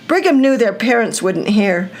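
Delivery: ordinary delivery, nothing marked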